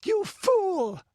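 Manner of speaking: very high, loud furious cry; frantic, high-pitched outrage; hysterical scream. A narrator's reading. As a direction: scream it, almost beside yourself. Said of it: "screamed Balessa".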